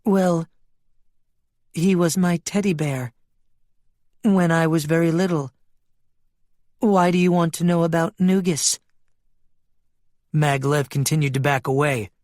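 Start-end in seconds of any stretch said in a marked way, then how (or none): 0.0-8.8 a hint of a wistful talking sheep; lightly voiced sheep, puzzled. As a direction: lightly play a sheep recalling childhood.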